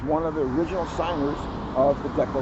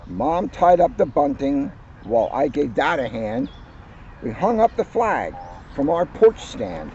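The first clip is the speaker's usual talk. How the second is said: lively, louder picture-book read-aloud; cheerful children's book narration; story reading; 4 semitones higher, 4 dB louder than usual. Acted. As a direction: read it like story time for kids. Reads picture book aloud to audience.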